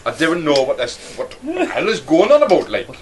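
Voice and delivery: put-on Geordie lilt, rising and falling; Newcastle accent. Comic telling mate he talks nonsense, Newcastle accent. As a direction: slip suddenly into a Newcastle accent. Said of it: "suddenly talking in a Newcastle accent".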